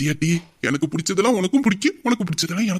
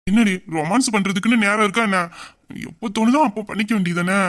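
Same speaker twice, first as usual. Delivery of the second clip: normal talking voice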